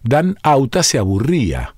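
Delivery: ordinary delivery, nothing marked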